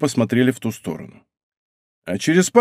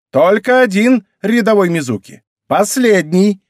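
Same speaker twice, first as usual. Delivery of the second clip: loud, raised military voice; sergeant's command; 8 semitones higher, 4 dB louder than usual. A narrator's reading. Рейка answers her private, commanding. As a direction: grant it sternly like a drill sergeant.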